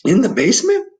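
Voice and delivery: put-on a child questioning the dark; Laszlo asking. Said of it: "asked Laszlo".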